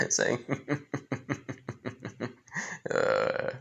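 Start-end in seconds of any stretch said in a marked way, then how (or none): 0.5-2.3 devil cackle. Acted. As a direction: cackle like the devil who just won. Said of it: "Lucifer starts cackling".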